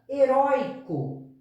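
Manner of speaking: Heróico drawn out, stress on the ró; slow emphasis. Shown as a demonstration. Teacher shows the class the word Heróico, slow and stressed. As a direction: hold up Heróico slowly as the example. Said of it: "ó, é uma paroxítona".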